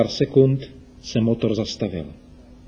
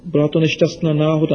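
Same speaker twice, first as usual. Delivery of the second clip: put-on quick and a bit higher, a guilty boy; hurried apology; 4 semitones higher, faster than usual. A narrator's reading. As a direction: rush the excuse out nervously. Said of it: "omlouval se Ríša". (spěšná, provinilá omluva)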